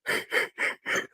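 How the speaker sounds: imitated breathy, voiceless snicker; cartoon wheezy snicker. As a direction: wheeze out a cartoon cat's laugh. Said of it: "What's that cat that always".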